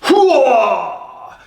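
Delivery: loud high hurrah cry; battle yell. Shown as a demonstration. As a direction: charge with a battle shout. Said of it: "put it on and just go like".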